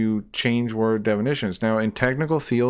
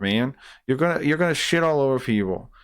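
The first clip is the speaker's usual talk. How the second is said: ordinary talk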